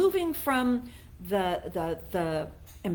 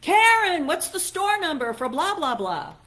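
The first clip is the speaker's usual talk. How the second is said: louder, higher call through the wall; imitating a yell; 8 semitones higher, 7 dB louder than usual. Boss calling Karen through the wall, mock yell. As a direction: call her name across the office. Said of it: "he would go yell in his office".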